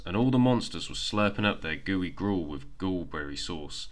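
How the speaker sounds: plain reading voice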